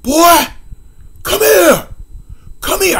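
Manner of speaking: high yelling call from the other room; mock furious yell "Boy, come here"; hollering stepdad. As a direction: holler for the boy like an angry father. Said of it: "I hear him holler".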